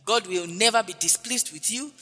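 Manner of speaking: ordinary talk, nothing marked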